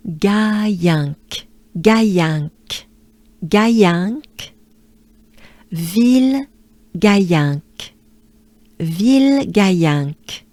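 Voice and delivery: Gaillinck and Ville Gaillinck repeated with a French A; French drill. Shown as a demonstration. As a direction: put the name back together slowly. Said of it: "French A".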